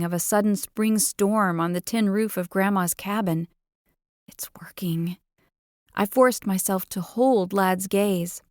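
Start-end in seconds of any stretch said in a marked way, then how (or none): 4.3-5.2 quick secret whisper to herself. Acted. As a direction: breathe it out as a hidden thought. Narrator's private triumphant thought.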